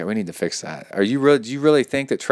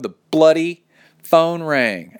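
higher, louder exclamation, drawn out; exclaimed; 5 semitones higher, 5 dB louder, slower than usual. Acted. Reader voicing the listener's annoyance at a phone call, exclaimed. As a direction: hit "bloody" hard and slow. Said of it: "Exclamation point".

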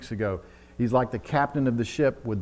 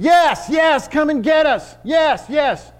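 high, loud desperate scream; screaming for help; 9 dB louder, slower than usual. Acted. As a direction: shout to the rescuer to come. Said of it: "And you're screaming".

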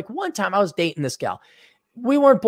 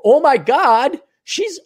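much higher, gasping out; mock alarm; 11 semitones higher, 5 dB louder, slower than usual. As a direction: sound shocked like a naive guy. Mocking naive guy's reaction.

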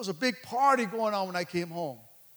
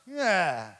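low, sinking mock yeah; grudging, half-hearted response; unhappy for him; 3 semitones lower than usual. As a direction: imitate someone who cannot share the joy. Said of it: "Instead of being happy for the brother".

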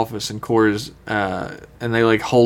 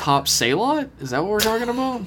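mock confusion; 10 semitones higher than usual. Mock confused Spock questions.

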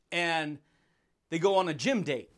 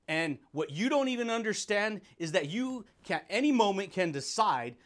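ordinary talk, nothing marked

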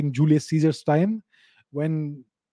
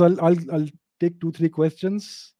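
ordinary delivery

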